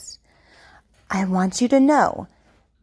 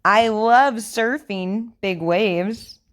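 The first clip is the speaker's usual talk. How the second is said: over enunciating. Shown as a demonstration. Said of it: "Repeat after me".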